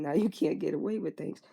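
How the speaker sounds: ordinary delivery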